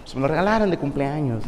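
low, soft, modest schoolboy reply; humble. Retold or imitated. Humble classmate explains his birthday watch to admirers, modestly. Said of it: "Y el vato humilde".

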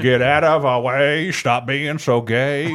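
slow, lightly voiced sneering rocker; mock rocker voice. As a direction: drawl it like a sneering band guy. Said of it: "the band that Adriana".

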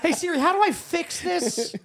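high, laughing plea; hungover. Pretend. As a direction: beg through laughter.

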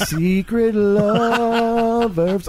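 slow, high, drawn-out tease; teasing. Real, not acted. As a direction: stretch it out teasingly.

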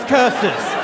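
a hint of a cartoon villain cursing; mock villain cry of defeat; Scooby-Doo villain. Dad cursing like a cartoon villain. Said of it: "Like a Scooby-Doo villain, he yells".

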